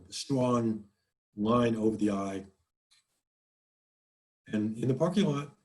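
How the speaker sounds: ordinary talk, nothing marked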